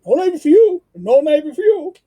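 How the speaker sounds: high, loud barked refusal, punching you; Soup Nazi impression. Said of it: "Like, no soup for you".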